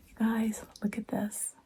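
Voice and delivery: secretive excited whisper. Real, not acted. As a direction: drop to a hush to show the treasure. Showing book to viewers.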